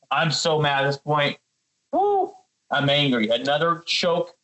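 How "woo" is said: a sharp, very high woo of exasperation; high whoop. Real, not acted. Fired-up rant about the game.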